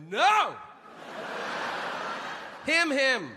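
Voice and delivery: keras, tinggi, panik lalu tertawa; buru-buru meralat dengan panik; panicked backpedal. Retold or imitated. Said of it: "I went".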